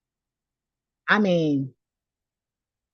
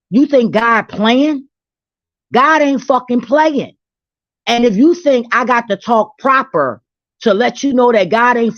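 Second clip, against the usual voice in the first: furious rant; 5 semitones higher, 5 dB louder than usual. Real, not acted. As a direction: lay into them like a warning sermon. Heated rant about God and cursing.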